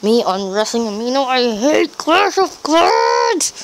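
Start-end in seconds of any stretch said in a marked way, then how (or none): 1.2-3.6 high, drawn-out mock-furious yell; slow, high-pitched tantrum cry; hammed-up rage. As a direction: wail your hatred at the game. Rant at game as sign-off.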